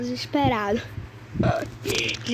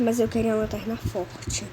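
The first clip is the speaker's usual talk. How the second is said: usual speaking voice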